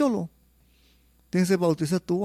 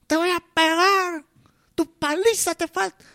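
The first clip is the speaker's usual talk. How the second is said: much higher, louder mock threat to a child; scolding mother; 3 dB louder than usual. Mother threatening her little child, higher, louder mock scolding. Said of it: "como una madre a su guagua".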